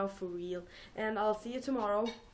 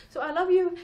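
higher and much louder burst of "So I love you."; hyper; 8 semitones higher, 11 dB louder than usual. Real, not acted. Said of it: "Oh, I'm so hyper".

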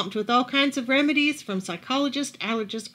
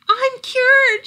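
very high, loud cry of joy; shouting; 13 semitones higher, 9 dB louder than usual. A narrator's reading. Camilla shouts to everyone that she is cured. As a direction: shout it out in triumph. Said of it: "she shouted".

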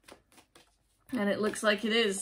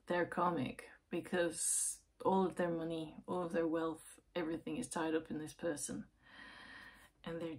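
softer, half-whispered confiding; hushed; 2 semitones lower, 7 dB quieter than usual. Real, not acted. Telling the client about the partner's money, hushed. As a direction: lean in and drop to a near whisper.

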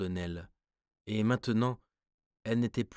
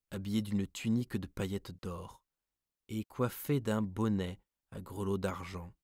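ordinary delivery

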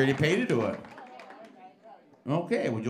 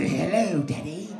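higher, louder daughter voice; hammed-up daughter greeting; mock ugly daughter; 5 semitones higher, 7 dB louder, slower than usual. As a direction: greet dad in a put-on girl voice.

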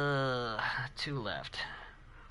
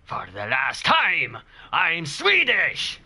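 very slow, exasperated character voice rising high; hammed-up protest, stretching each word; drawn-out, indignant game hero; character impression; 4 semitones higher, much slower than usual. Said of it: "That's my best horror impression".

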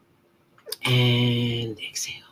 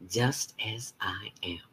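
slow unvoiced whisper; whispered close; soft whisper; 7 dB quieter than usual. Shown as a demonstration. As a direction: let it fade into a breath. Whispered ending of affirmation.

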